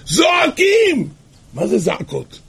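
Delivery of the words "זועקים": very high, long-held cry; imitated crying out. Couple cry out to God at the Seder, wailing shout. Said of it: "זעקות נוראות".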